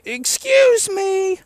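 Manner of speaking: very high, slow, drawn-out "Excuse me!"; shriek-stretch each "Excuse me" way up high like the booed heel; hammed-up high mock heel screech, slow and insistent; Vicky Guerrero's "Excuse me!". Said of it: "keeps saying".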